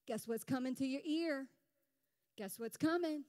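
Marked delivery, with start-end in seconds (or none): none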